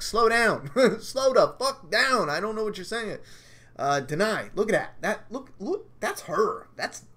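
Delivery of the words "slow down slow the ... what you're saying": mock plea. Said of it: "I'm like".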